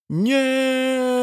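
high, long-held scream of refusal; drawn-out wail; desperate cry. A narrator's reading. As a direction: cry out on one sustained high note. Victim cries out from behind.

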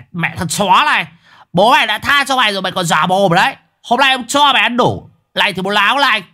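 high, furious tirade; worked-up angry cursing, pitched high; enraged threats. Acted. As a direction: rage at him while laying into him. Enraged man resumes beating him.

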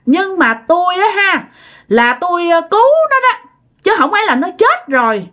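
an uncanny guest speaking through the father; possessed stranger voice. Retold or imitated. Spirit in the father demanding food from family, high eerie voice. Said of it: "ba nói".

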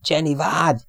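high, urgent plea to wait; urgent call. A narrator's reading. Radioman stops his crewmate jumping, urgently. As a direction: call out to stop someone. Radioman stopping panicked airman.

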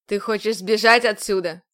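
a commander bearing down on her; stern commander. A narrator's reading. Commander role.

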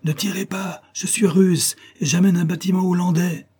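high-pitched call through a speaking trumpet. A narrator's reading. Elim hails the fleet firing on him, calling out. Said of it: "prit un porte-voix et cria".

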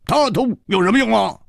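high, animated character voice with stretched words; high-pitched scoffing banter; boisterous friend. A narrator's reading. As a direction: protest it high, drawing out key words. 蝴蝶花 loudly defending his friend.

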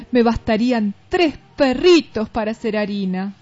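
plain reading voice

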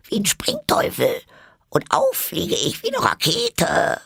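sehr hohe, kleine, schwache Quiekstimme; quiekende Stimme. A narrator's reading. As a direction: quieken wie eine kleine Eidechse, aufgeregt. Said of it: "kleine, schwache, quiekende Stimme". (Wabbel quiekt den Tieren aufgeregt seinen Flug vor)

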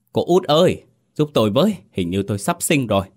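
panicked calling out, urgent and scared; panicked call for help. A narrator's reading. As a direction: call out in panic, pain rising. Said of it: "hoảng hốt gọi lớn".

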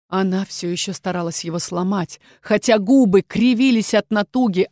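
plain reading voice

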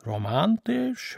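a friend mocking the room, slow and sneering. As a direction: sneer the word out, drawn slow and high. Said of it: "höhnte er".

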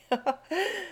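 high little laugh; high giggle. Acted. Bates giggles, picturing Jack's speech. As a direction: giggle at the joke.

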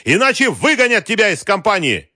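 loud, high, angry ultimatum; shouted threat. A narrator's reading. Wolf threatening the general's job, shouting. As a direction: yell it as a furious threat. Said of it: "крикнул Вольф".

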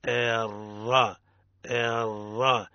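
"الر" very slow, ra held without trill; correct ra. Shown as a demonstration. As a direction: stretch "الر" out without rolling it. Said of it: "الصواب هو". (راء دون تكرار ببطء شديد)

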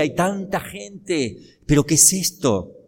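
voz aguda de espectador atónito; asombro de multitud. Acted. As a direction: imita a la gente pasmada ante el espectáculo. (asombro actuado, en tono alto)

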